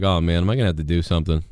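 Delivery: low, fast, lightly voiced nervous bystander. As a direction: mutter it like a worried onlooker, quick and low. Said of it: "He's like".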